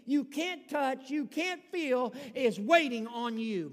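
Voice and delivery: a rising, insistent sermon push; preaching with force. Acted. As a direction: press each phrase home to the church.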